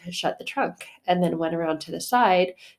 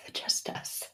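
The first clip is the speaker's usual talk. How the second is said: breathy whisper, much softer; whispered aside; 12 dB quieter than usual. Real, not acted. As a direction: drop to a secret whisper. Aside after laughing.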